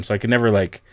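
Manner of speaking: ordinary delivery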